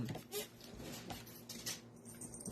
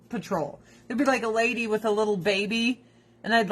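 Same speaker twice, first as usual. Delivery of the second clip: usual speaking voice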